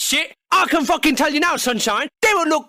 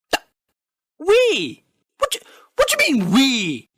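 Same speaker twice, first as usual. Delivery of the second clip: incredulous. Real, not acted. Incredulous at fan's "we.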